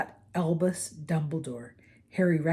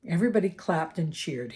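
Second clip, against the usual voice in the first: ordinary delivery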